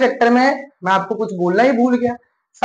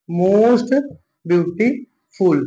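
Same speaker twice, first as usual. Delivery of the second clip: slow dictation; much slower than usual. Shown as a demonstration. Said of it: "क्या हो जाएगा".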